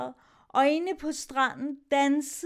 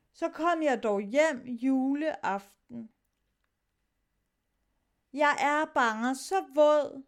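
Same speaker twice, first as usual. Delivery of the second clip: plain reading voice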